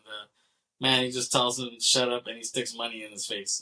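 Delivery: usual speaking voice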